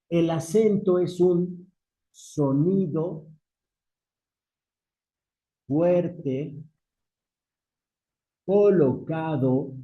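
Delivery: word by word with long pauses; measured, widely spaced phrases; slow dictation. Real, not acted. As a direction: dictate slowly for note-takers. Dictates a definition to students.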